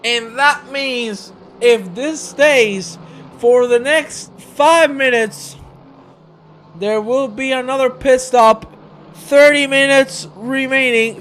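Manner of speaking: high, loud race commentary; excited live play-by-play. Real, not acted. Commentator explaining what the weather means for pit stops, excited call. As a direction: project it urgently to the viewers.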